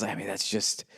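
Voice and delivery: soft, halting mumble; a hint of a lost-for-words guest; mumbling guest. A guest fumbling for a reaction. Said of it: "And he says".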